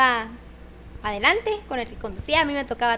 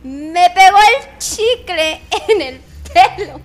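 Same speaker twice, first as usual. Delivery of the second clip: loud, drawn-out outraged yell, pitched up; retold scream; 4 semitones higher, 12 dB louder, much slower than usual. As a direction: re-enact a slow, horrified scream. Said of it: "lo grité". (grito indignado, fuerte y alargado)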